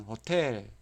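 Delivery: 호텔 stressed on the last syllable, 텔; back stress. Shown as a demonstration. Teacher showing the exception, 호텔 with end stress. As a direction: hold back on 호, then hit 텔 in 호텔. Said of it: "유일하게 뒤에 있는 게".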